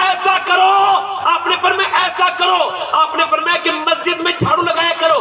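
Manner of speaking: shouted Urdu sermon, slowing at the end; impassioned preaching. Real, not acted. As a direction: build the crowd to the punchline. Preaching at full force.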